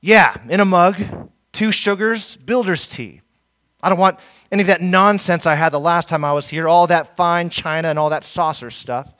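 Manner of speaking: loud and blunt, a touch of mock rudeness; rough builder. Preacher voicing a rude builder demanding a mug of tea. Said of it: "this builder responded roughly".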